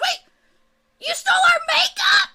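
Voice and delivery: high, loud furious goblin shriek; high furious goblin scream; outraged shriek. Acted. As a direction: shriek the accusation. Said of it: "She goes".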